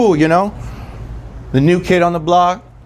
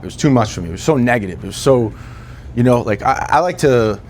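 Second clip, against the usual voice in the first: ordinary talk, nothing marked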